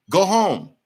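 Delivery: ordinary talk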